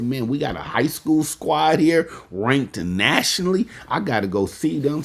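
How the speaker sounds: like you just found a nationally ranked team in your city; a hyped young man talking to himself; excited self-quote. Retold or imitated. Himself excited, reading rankings.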